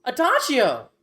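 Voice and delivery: put-on high, loud child yelling a name; excited kid shout. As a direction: shout it out like a starstruck kid spotting a hero. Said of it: "he just points and goes".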